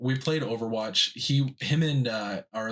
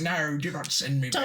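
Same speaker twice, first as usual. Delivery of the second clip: high whiny goblin plea; goblin pleading; 4 semitones higher than usual. Pretend. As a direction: beg like a goblin. Goblin pleads being sent back.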